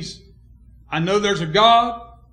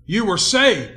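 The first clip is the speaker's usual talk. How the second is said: normal talking voice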